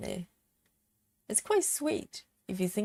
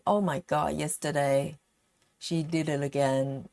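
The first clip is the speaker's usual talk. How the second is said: normal talking voice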